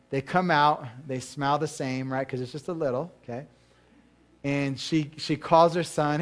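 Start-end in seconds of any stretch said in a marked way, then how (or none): none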